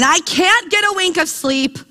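plaintive, acted-out grumble; complaining. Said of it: "he goes back to complaining".

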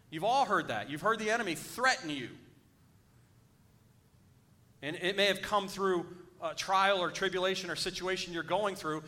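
rally the congregation against fear; fiery preaching. Acted. Preacher builds to exhortation.

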